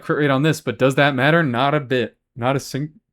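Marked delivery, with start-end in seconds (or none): 2.3-3.0 hiccuping mid-sentence; a hiccup breaks the words. Real, not acted. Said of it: "I got the hiccups".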